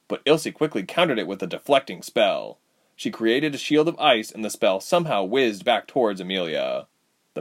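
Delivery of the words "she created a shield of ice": plain reading voice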